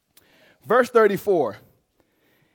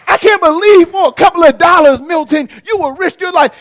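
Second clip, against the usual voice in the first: wife mad at him; 8 semitones higher, 6 dB louder than usual. Retold or imitated. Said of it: "how she say my name when she mad at me".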